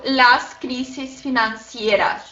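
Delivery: slow, spelled-out Spanish plural; slow example. Shown as a demonstration. Teacher giving students the plural example, slow demonstration. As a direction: say "las crisis financieras" slowly and distinctly. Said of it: "e no plural".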